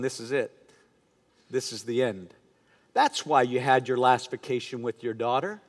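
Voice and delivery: low, hushed, ominous inner voice; voice of doubt. Acted. The enemy putting doubt into a sick pastor's mind, low and hushed. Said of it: "putting doubt into my mind".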